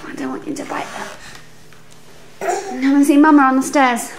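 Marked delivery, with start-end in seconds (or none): 0.0-1.1 unvoiced whispered warning. Real, not acted. Mum whispering to baby not to bite. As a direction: whisper it gently. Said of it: "whispered warning to baby".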